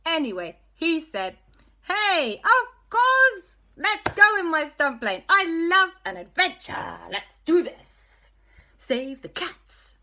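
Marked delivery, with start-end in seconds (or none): 1.8-10.0 put-on loud, high hammy Italian accent. El Fuego agrees to fly the stunt plane, in a hammy Italian accent. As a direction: boom it out like an Italian hero pilot. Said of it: "He's turned Italian".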